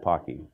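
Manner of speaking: Pocky as a low, soft "POCK-ee"; correct pronunciation. Shown as a demonstration. Presenter settling the name dispute, Pocky as a low "POCK-ee". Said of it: "It is, in fact".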